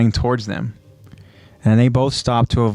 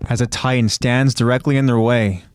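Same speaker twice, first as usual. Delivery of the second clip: usual speaking voice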